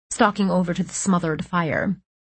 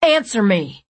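shouting; 3 semitones higher, 5 dB louder than usual. A narrator's reading. Angry demand.

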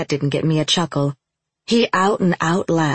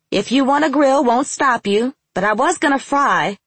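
yelling; 9 semitones higher than usual. A narrator's reading. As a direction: yell it across the house. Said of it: "yelled back".

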